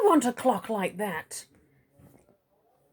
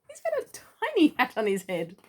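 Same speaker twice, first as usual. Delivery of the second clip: usual speaking voice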